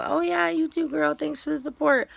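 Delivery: high, sugary fake-nice voice; fake friendly. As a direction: gush with fake sweetness. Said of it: "I'll pretend to be friendly".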